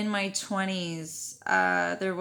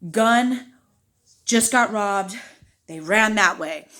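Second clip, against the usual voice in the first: loud fragments with pauses between; blurted report; 2 semitones higher, 8 dB louder, slower than usual. Retold or imitated. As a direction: force out each phrase as if winded. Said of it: "The only thing that I can get out".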